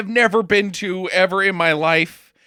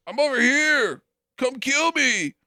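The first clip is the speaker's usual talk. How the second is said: higher, slowing taunt to the hunter; taunting hero; 8 semitones higher than usual. Retold or imitated. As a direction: call out to lure the killer. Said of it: "totally doing the Predator thing".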